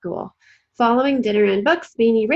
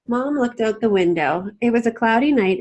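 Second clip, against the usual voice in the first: ordinary delivery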